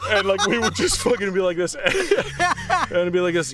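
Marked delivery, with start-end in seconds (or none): 1.8-2.9 high falling giggle; high laugh. Real, not acted. Salesman laughs recalling the prank on truckers.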